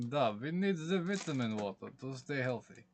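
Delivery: hammed-up, poor Russian accent. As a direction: attempt a Russian accent, pitch swinging up then falling. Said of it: "my poor attempt at a Russian accent".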